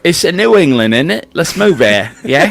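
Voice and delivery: 가벼운 영국 억양 흉내; mock British accent. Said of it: "The accent?".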